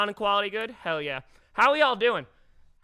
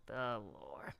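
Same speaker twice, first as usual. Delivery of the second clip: "The lore" said much lower and softer, dragged out; deep ominous murmur; 10 semitones lower, 12 dB quieter than usual. Shown as a demonstration. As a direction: drop it low and quiet like a dark legend. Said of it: "repeats the phrase, voice dropping".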